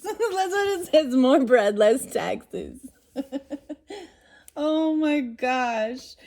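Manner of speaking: laughing while repeating the slogan. Real, not acted. To viewers at the funny slogan, laughing. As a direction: giggle through the slogan.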